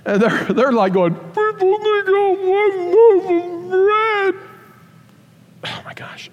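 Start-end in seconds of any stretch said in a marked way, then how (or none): 1.1-4.3 high, slow mock lament; lightly voiced fretting disciple; whiny disciple. 5.7-6.3 breathed-out disbelief; hushed facepalm; exasperated whisper. Real, not acted. Exasperated aside.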